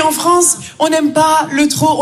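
ordinary talk

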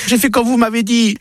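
high, pleading woman's voice; Sarah complaining. Acted. Sarah pleading with her doctor, high voice. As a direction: beg the doctor for help, up high.

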